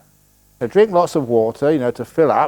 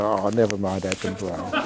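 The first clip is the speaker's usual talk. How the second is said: soothing teddy bear; 6 semitones lower, 6 dB quieter than usual. Pretend. Teddy bear consoles sad owner.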